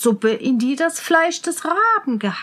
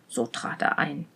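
usual speaking voice

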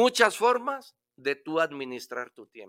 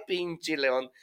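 ordinary talk, nothing marked

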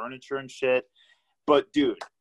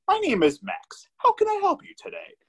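high, fast, chirpy customer-service robot; mock the cheery phone support bot; automated phone voice. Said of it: "the stupid automated voice".

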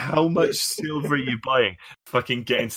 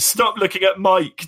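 sharp high scold, louder; mock scolding; 8 semitones higher, 4 dB louder than usual. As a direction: snap it like a nagging wife. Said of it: "did you say?".

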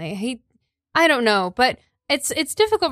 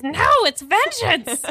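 much higher, slow and stubborn; high-pitched house elf; 8 semitones higher than usual. Acted. House elf correcting the teacher, high and stubborn. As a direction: insist like a defiant little elf. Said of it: "high-pitched voice".

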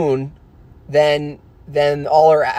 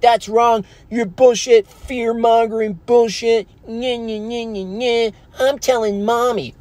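high, whiny brat voice; whiny child; 9 semitones higher than usual. Pretend. Mocking an angry viewer as a tattling child, high and whiny. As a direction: whine like a tattling kid, nyeh nyeh. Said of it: "by being like".

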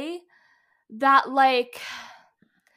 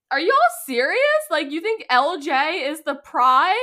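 louder, higher mock disbelief; incredulous; 4 semitones higher, 7 dB louder than usual. Reacting to fans of the player, retold incredulous outburst. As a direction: challenge them in retold disbelief, pitched up.

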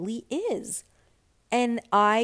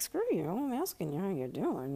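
softer, joking mock retort; playful retold irritation; mock indignation; 5 dB quieter than usual. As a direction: grumble it as a joke. Joking retold inner retort, then laughs.

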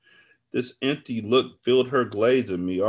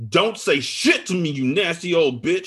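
high, loud angry outburst; thundered; 8 semitones higher, 5 dB louder than usual. A narrator's reading. Friend cuts off narrator's excuses, thundering. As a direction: thunder the insult at her. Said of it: "she thundered".

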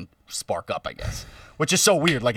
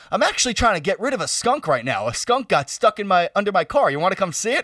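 put-on lightly raised, eager voice of a girlfriend's dad; excited dad; 7 semitones higher than usual. Dad inviting him to see a skunk, lightly voiced eager. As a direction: retell the dad's eager invite, a bit higher.

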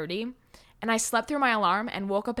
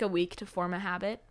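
ordinary talk, nothing marked

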